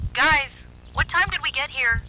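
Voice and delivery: high, loud, rising panic; alarmed. A narrator's reading. Tyler seeing the time on his phone, panicked. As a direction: blurt it out as fear hits.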